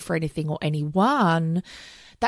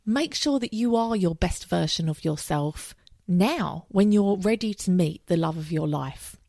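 usual speaking voice

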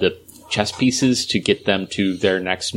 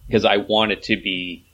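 normal talking voice

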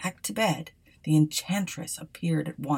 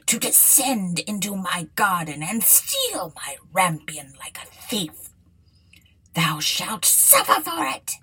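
angry enchantress. A narrator's reading. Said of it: "she said with an angry look".

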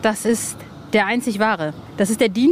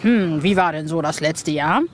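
lower, a hint of a stiff boss; imitated boss; 5 semitones lower than usual. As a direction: lightly voice the office boss asking. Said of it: "der Chef da sitzt und, äh, dann sagt". (leicht gespielter Chef, tiefer)

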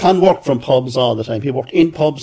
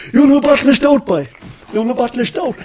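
higher, louder, coaxing pub voice; imitating Con; 11 semitones higher, 4 dB louder than usual. Con coaxing the junior reporter to drink, jovial. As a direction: press a stout on the young lad. Con urging stout on boy.

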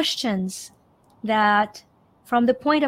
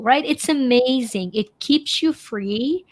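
normal talking voice

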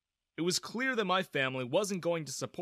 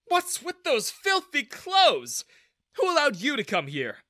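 loud, high, disgusted manager; harsh, scornful tone; 12 semitones higher, 8 dB louder than usual. A narrator's reading. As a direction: berate an unwelcome visitor, stretching the words. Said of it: "I was shocked at his tone".

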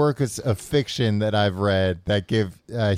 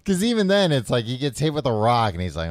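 normal talking voice

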